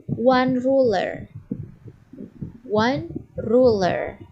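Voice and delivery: one ruler, drawn out slowly; slow teacher's demonstration for copying; repeat-after-me model. Shown as a demonstration. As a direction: say it slowly so learners can echo. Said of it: "repeat after me".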